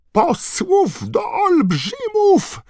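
przeraźliwie wysoki, głośny wrzask; wrzaśnij cienko i piskliwie, ze zgrozą; piskliwy wrzask. Acted. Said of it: "wrzasnął Knot piskliwym głosem". (piskliwy wrzask)

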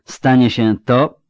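an emperor's slow, measured verdict; drawled out. Acted. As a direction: spell out each word coldly. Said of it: "wycedził".

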